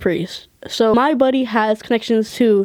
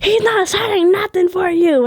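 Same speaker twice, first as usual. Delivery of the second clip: high-pitched imitation of a rude refusal; mock yell, pitched way up; mock yelling manager; 8 semitones higher than usual. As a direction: mimic a manager snapping at a fan. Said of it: "yelled at me that she wasn't".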